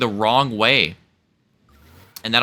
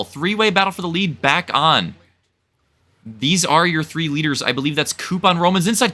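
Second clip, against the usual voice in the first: excited play-by-play; 7 semitones higher than usual. Real, not acted. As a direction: call a three-way fight for the lead. Excited call of three-way battle.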